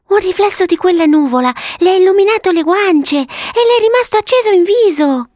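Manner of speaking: put-on very high, thrilled child voice; excited little girl. Violetta showing her brother the glowing cheeks, very excited. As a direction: marvel excitedly, pitch climbing. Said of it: "Violetta tutta eccitata".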